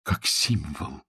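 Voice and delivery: very soft, hushed and solemn; quiet voice. A narrator's reading. Woman returns the gift as a symbol, quietly. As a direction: let it fall almost away. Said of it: "проговорила она тихим голосом".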